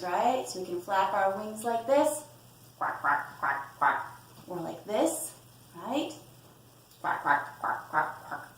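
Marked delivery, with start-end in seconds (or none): 2.8-4.1 low, raspy play quacks; short repeated duck calls; quacking like a duck. Pretend. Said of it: "quack like a duck". 7.0-8.5 low, raspy play quacks; short repeated duck calls; quacking like a duck. Pretend. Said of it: "quack like a duck".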